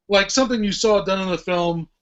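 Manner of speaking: ordinary talk, nothing marked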